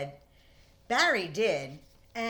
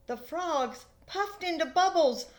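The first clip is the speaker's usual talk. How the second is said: plain reading voice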